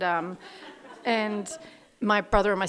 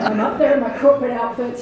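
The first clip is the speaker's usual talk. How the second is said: ordinary talk, nothing marked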